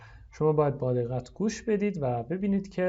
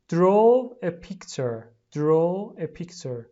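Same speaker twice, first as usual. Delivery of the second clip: slow teacher model; 3 semitones higher, 6 dB louder, much slower than usual. Shown as a demonstration. Teacher models the phrase for pupils, slow. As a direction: Draw a picture stretched out slowly, first take louder. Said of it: "لطفاً تکرار کنید".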